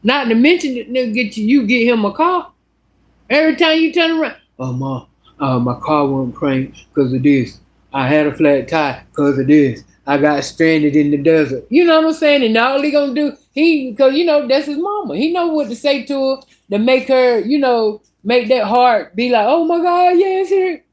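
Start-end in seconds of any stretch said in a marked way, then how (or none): 4.6-11.6 lower, put-on whiny voice of a grown son asking mom for help; mimicking her son. Son's excuses voiced. 19.5-20.8 mock gushing mom. Voicing the softened mother.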